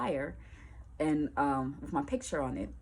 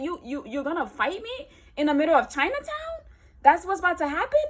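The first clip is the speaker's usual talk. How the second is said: high, loud disbelieving question; incredulous challenge; 12 semitones higher, 7 dB louder than usual. Retold or imitated. She asks the girl if she'll fight, loud disbelief. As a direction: ask if she really wants to fight.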